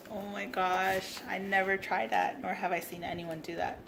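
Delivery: normal talking voice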